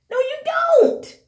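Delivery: very high, slow sing-song no, you don't; mock sing-song no. Real, not acted. Vlogger taunting the stars, in a high slow sing-song. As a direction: float it up high and drag it out teasingly.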